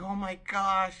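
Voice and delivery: high-pitched wonder; acted wonderstruck woman; imitated amazement. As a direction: mimic a woman taken aback, voice lifted. Voicing the amazed woman again.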